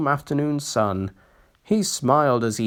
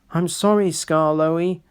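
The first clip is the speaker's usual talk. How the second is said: ordinary delivery, nothing marked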